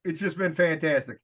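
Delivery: ordinary delivery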